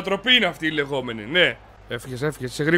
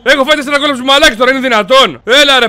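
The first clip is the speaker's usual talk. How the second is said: fast, loud, higher ranting; angry shouting; 6 semitones higher, 5 dB louder than usual. Real, not acted. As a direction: rant in outrage at your players. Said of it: "Μη φωνάζεις εδώ στο μικρόφωνο". (θυμωμένο, γρήγορο ξέσπασμα)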